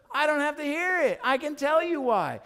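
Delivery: very high, acted outburst; exasperated protest. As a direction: shut him down as a fed-up wife. Wife refuses to hear excuse.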